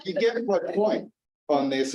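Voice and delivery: ordinary talk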